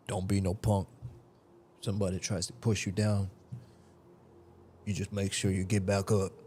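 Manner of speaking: put-on light country-accented dad voice. A dad telling his kid not to be a punk, light country accent. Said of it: "I gave it a country accent".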